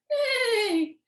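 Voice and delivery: high imitated whinny, falling away; whinny like a horse. Shown as a demonstration. Teacher showing children how a horse sounds, high-pitched whinny. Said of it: "a horse whinnies".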